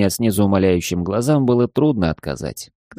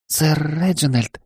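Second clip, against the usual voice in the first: put-on shy young maid; 7 semitones higher than usual.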